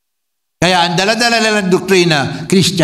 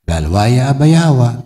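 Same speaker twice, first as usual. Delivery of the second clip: solemn benediction; 3 semitones lower than usual. Acted. Preacher blessing the congregation at the close, solemn.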